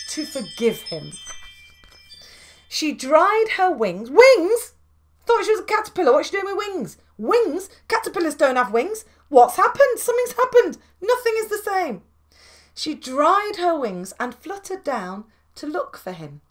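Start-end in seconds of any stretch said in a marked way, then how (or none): 4.1-12.0 higher, louder baffled exclamations; astonished. Real, not acted. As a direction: act amazed at the sudden wings. Astonished aside about wings.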